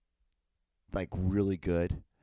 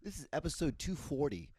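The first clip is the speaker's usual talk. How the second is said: ordinary delivery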